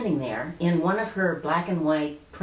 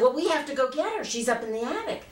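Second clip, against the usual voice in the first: put-on high little-girl pleading; insistent child; 9 semitones higher, faster than usual. Child urging her mother up to the attic, insistent.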